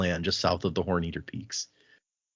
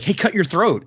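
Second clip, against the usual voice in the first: casual order; 9 semitones higher, 4 dB louder than usual. Retold or imitated. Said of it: "he's like".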